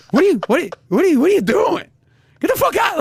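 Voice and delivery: high, loud, sputtering indignation; hammed-up angry disbelief; mock outrage. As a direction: act out an appalled diner throwing someone out. Acting out an outraged diner.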